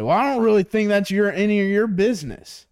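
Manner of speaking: high, affronted customer voice; put-on offended pushback; indignant refusal. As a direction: imitate a homeowner getting huffy on the phone. Imitates customer refusing to answer.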